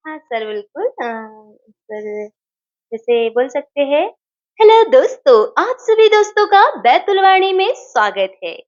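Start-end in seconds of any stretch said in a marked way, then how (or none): 4.6-8.6 high, loud cartoon greeting; chirpy cartoon character voice. Shown as a demonstration. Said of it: "बोल के बताइए ना cartoon वाली आवाज में".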